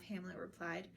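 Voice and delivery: usual speaking voice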